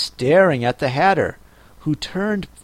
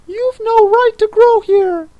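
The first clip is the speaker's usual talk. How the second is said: sleepy snoring dormouse; 8 dB louder than usual. Acted. Dormouse scolds Alice.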